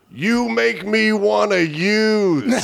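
high, drawn-out mock yell; imitated heckler's yell. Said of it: "He yells out".

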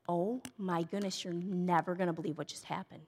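low, soft husband impression; hushed amazement. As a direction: retell it low and quiet, as marveling news. Husband retold sharing amazing news.

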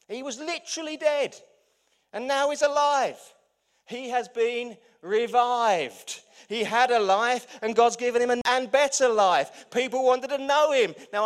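full-force preaching. Acted. Preacher proclaiming Lazarus revived, loud triumphant climax. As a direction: shout the good news to the back row.

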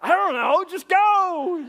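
high, loud acted impatience; exasperated shout. Teen pushing friend to jump from truck, impatient shout. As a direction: holler it to hurry him off.

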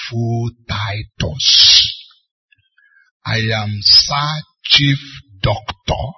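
slow, low, pompous indignation; mock indignant anger. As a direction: weigh out each title with wounded self-importance. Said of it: "Why is he so angry?".

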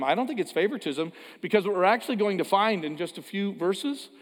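ordinary talk, nothing marked

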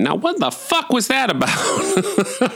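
high, drawn-out baffled outburst; baffled disbelief. Retold or imitated. Viewer leaving a horror movie utterly confused, baffled disbelief. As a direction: squeal it in exaggerated bewilderment, nearly laughing. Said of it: "leaving the movie thinking".

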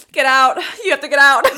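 the TV host shouting the cameras out of backstage; mock shout. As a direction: shove them out loudly, playing Wendy. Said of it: "she's like".

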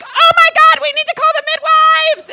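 very high, loud re-enacted shriek; panicked scream. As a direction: scream for help mid-contraction. Panicking in labour, retold.

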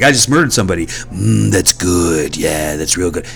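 crazy Santa. Pretend. A crazy Santa approving murder, mock creepy and sinking low. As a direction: play a crazed killer, lightly. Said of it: "really crazy and be like".

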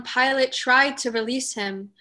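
ordinary talk

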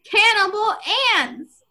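mock cry, starting high then dropping; breathless cry. A narrator's reading. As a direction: act out a mildly breathless outburst. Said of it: "Bobby cried breathlessly".

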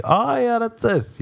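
high-pitched sarcastic echo; mock agreement. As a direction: jump high, mocking a friend's claim. Mocking friend's claim about tiny meals.

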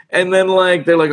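usual speaking voice

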